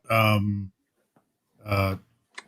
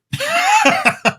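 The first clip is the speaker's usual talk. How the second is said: sudden squealing laugh; high burst of laughter; 3 dB louder than usual. Real, not acted. Laughs at own admission.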